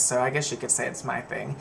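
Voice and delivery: normal talking voice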